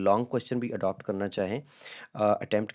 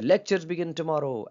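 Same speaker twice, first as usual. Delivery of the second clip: higher and stretched, "Lectures" falling high; excited variant; 6 semitones higher, much slower than usual. Shown as a demonstration. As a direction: another eager take. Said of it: "different intonation के साथ पढ़ेंगे".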